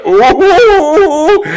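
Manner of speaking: high squealing mock laugh; a high, loud laughing yelp; imitated helpless laugh. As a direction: squeal it out like someone doubled over laughing. Jimmy laughing hard, imitated.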